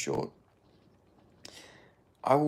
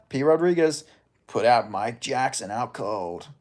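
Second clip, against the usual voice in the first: usual speaking voice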